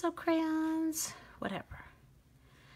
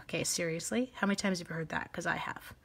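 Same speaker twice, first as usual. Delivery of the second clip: normal talking voice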